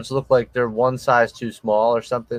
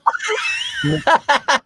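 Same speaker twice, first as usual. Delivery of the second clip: big shrieking laugh. Real, not acted. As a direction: burst out laughing. Laughing at Lego noise.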